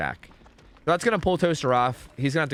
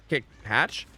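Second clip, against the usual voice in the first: usual speaking voice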